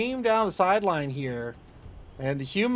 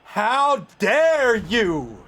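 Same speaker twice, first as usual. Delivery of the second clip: slow, loud mock outrage; drawn out, hammed-up indignation; shaking with anger; 6 semitones higher, 7 dB louder, much slower than usual. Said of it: "mock outrage at opponent".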